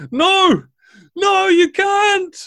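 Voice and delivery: put-on high, loud, panicked cry of a young girl; terrified scream. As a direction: scream it in pure terror to stop them. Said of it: "looks in absolute terror at him and says".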